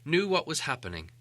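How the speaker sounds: ordinary delivery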